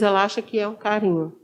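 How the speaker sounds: ordinary talk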